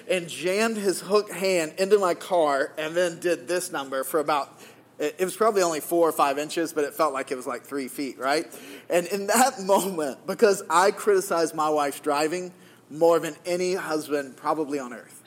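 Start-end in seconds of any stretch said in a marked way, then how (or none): none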